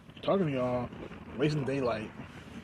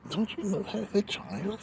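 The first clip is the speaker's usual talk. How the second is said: mock scolding; 11 semitones higher, slower than usual. Mock scolding someone offscreen.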